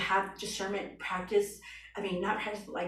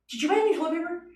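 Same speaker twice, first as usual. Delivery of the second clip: high-pitched, louder mock question; example question; 10 semitones higher, 4 dB louder, much faster than usual. Someone asking a simple question, high-pitched put-on voice. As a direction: pop up high for the sample question.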